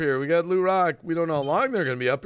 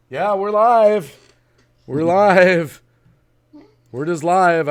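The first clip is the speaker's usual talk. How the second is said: loud; 9 dB louder, much slower than usual. Real, not acted. Host loudly announcing going live.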